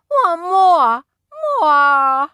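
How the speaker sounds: put-on a toddler pleading for another bite; toddler begging.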